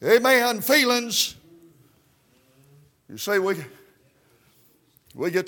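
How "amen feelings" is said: high, loud revival preaching with gasped "uh" between phrases; fiery country Baptist sermon cadence; full-force preaching. Real, not acted. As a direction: preach it hard, driving every phrase up high.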